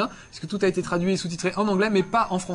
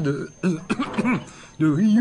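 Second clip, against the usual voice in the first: frog in throat; slower than usual. Real, not acted. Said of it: "j'ai un truc dans la gorge".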